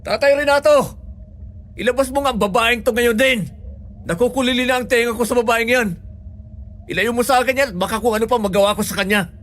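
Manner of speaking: high-pitched, angry calling out; exasperated yell. Acted. Patient yelling for Tatay Renato to remove the nurse, fed up.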